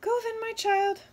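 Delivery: high, loud wren voice; a tiny bird speaking loudly to the child. Acted. Wren grants the wish.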